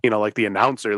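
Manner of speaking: usual speaking voice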